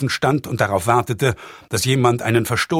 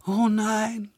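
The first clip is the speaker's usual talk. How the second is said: moaning; 10 semitones higher, 4 dB quieter than usual. A narrator's reading. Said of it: "stöhnte das Mädchen".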